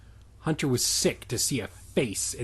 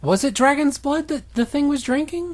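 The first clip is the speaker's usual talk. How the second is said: high, loud disbelieving question; baffled and loud; incredulous; 13 semitones higher, 7 dB louder than usual. Real, not acted. Incredulous question about the plot.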